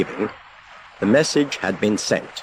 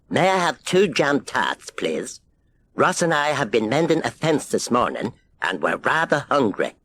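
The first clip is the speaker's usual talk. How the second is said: polite crofter; 4 semitones higher than usual. A narrator's reading. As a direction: ask kindly for tarts. Crofter orders in shop.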